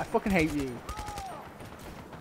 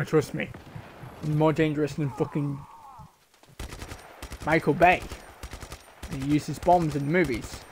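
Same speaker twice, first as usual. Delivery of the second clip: ordinary talk, nothing marked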